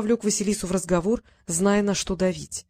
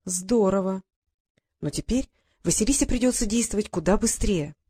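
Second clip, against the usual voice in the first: ordinary delivery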